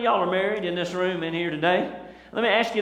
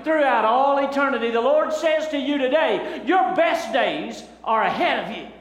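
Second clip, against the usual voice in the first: full-force preaching; 5 semitones higher than usual. Acted.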